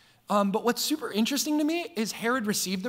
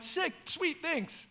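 much higher, softer, airy brush-off; mock breezy thanks; 9 semitones higher, 6 dB quieter, much slower than usual. Preacher brushing off praise, higher and softer mock nonchalance. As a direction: toss it off lightly, up high. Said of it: "I'm like".

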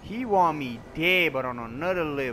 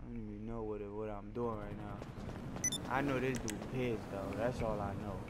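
much softer and lower; low mutter; 7 semitones lower, 13 dB quieter than usual. Real, not acted. Confused while playing, quiet low mutter. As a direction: mumble it under your breath.

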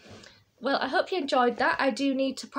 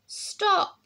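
high, mildly raised shout; storybook shout; 8 semitones higher, 3 dB louder than usual. A narrator's reading. Child to Bear making bubbles, mild high shout. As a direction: pitch it up like a child calling out. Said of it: "I shouted".

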